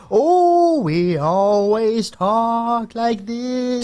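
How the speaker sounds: high, slow, drawn-out wail; spooky ghost voice. Retold or imitated. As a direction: moan it like a cartoon ghost. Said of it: "This is like a real ghost voice".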